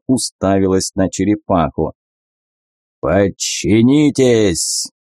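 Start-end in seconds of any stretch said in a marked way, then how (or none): 3.0-4.9 slow roaring lion voice. Acted. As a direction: roar it furiously like a lion. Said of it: "яростный рык льва".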